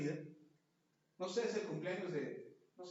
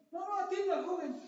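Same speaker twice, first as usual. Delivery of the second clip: much higher, overpolite and laughing; mock overpolite; 12 semitones higher, 6 dB louder than usual. Too-polite customer pretending to let the young man go first, higher, laughing. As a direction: play the shy customer waving the young man ahead.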